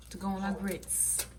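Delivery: ordinary talk, nothing marked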